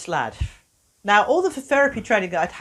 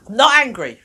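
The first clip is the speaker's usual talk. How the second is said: put-on louder, flat-out insistence from a sulky boy; stubborn denial; 4 semitones higher, 9 dB louder than usual. Boy refusing to admit to the therapist he is angry, loud and stubborn. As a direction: slam the denial down like a cross kid.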